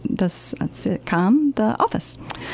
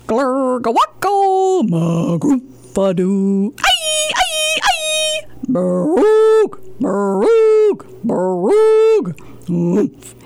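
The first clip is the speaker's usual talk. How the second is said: silly nonsense sounds; 8 semitones higher, much slower than usual. Acted. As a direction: go all out on the nonsense noises. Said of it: "make silly sounds like".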